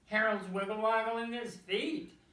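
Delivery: joyful proud exclaim; crying out. A narrator's reading. Mister Duck seeing Harold swim, excited cry. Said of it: "cried Mister Duck".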